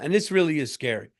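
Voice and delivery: ordinary talk, nothing marked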